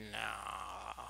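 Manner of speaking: very low, quiet drawn-out no; noncommittal no. Retold or imitated. Imitating Han shaking his head at an offer, noncommittal no. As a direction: mumble a half-hearted refusal. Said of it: "his no was not very".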